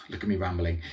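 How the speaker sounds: usual speaking voice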